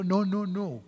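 usual speaking voice

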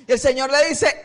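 normal talking voice

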